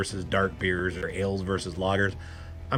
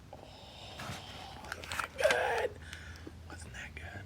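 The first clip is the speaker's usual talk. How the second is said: whispered; 15 dB quieter than usual. Real, not acted. After sip, savoring.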